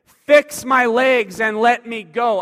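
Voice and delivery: slow, loud, weight on my; paralyzed man demanding his legs back, slow and loud. Acted. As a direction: insist on it, slowly.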